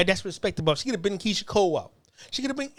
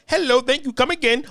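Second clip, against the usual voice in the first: mock Indian accent; 5 semitones higher than usual. Ranting about a politician's fake accents, mock Indian accent. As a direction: put on a mocking Indian accent. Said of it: "Why she use an Indian accent?".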